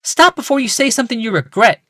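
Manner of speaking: a polite wife, lifted and high; Rebecca. A narrator's reading. Wife greeting her husband, high woman's voice.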